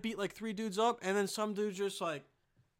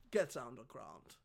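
much lower, growled menace; tough-guy cop voice; 9 semitones lower, 7 dB quieter than usual. Pretend. Vlogger playing a gun-toting tough guy, low growled menace. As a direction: drop into a gritty action-hero threat. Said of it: "I don't know what that was".

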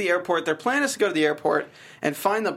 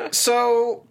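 ordinary talk

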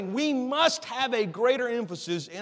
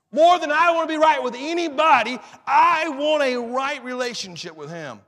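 raised, louder opening easing to a firm close; fervent preaching; 6 semitones higher, 6 dB louder than usual. Real, not acted. Preacher declaring he wants to be right with God, fervent preaching.

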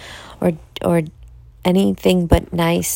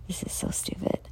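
whispered aside to herself; breathy whisper, barely audible; 13 dB quieter than usual. Real, not acted. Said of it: "And I'm falling asleep".

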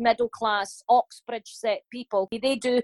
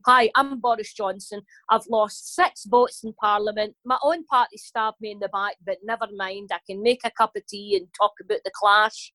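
Boris Johnson in Scottish accent. Shown as a demonstration. Comedian translates politician into everyday speech, own Glasgow accent. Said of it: "I'm doing it in my accent".

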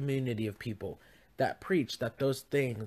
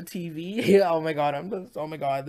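laughing; 3 semitones higher, 6 dB louder than usual. Real, not acted. Cracks up at herself mid-sentence.

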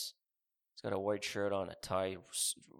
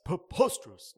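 a loud, higher, put-on preposterous; failed Stephen A impression; 7 semitones higher, 7 dB louder than usual. Imitating Stephen A yelling on TV, badly. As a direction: bark it like a TV pundit. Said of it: "No, that's not an impression".

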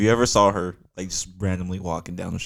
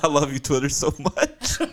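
high, through giggles; laughing; 8 semitones higher, slower than usual. Real, not acted. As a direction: gush while cracking up. Laughing praise of Twitter.

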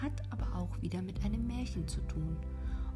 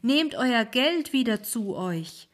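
high, loud horrified cry; the ferryman cries out in alarm; higher, louder panicked ferryman; alarmed old ferryman; 5 semitones higher, 7 dB louder than usual. Acted. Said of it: "rief der Alte".

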